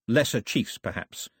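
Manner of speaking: plain reading voice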